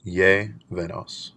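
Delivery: Llevenos said slowly, stress on the LLE syllable; slow demo, leaning on the first syllable of Llevenos. Shown as a demonstration. Teacher shows the class where the stress falls, slowly stressed. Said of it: "you can hear the emphasized ye".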